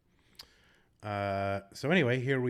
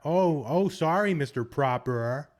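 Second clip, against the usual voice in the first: higher, louder hammed-up sarcastic Bostonian; mock Boston accent; 4 semitones higher, 5 dB louder than usual. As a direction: overcorrect the R at the end of "Proper", mockingly. Mocks sarcastic overcorrecting Bostonian.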